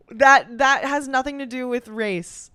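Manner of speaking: normal talking voice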